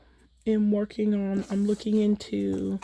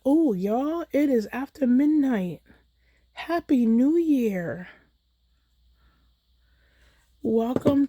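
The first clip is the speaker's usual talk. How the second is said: higher-pitched New Year cheer; delighted surprise; 5 semitones higher, slower than usual. Real, not acted. Crafter wishing viewers Happy New Year after midnight, delighted. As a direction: celebrate it, stretching out the greeting.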